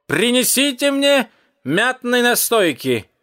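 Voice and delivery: put-on high, drawn-out drunken order; cat's high, stretched call. A narrator's reading. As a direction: holler the order slowly across the bar. Cat calls out drunken order.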